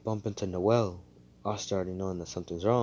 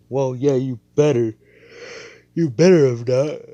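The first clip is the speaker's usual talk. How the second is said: loud, high, hammed-up indignation; haughty scolding; 6 semitones higher, 13 dB louder than usual. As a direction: imitate a snooty royal telling someone off. Snobbish girl answers the apology.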